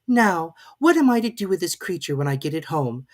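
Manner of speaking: put-on high, lightly girlish Alice; young girl scolding a baby; Alice's voice. A narrator's reading. Alice voiced in read story.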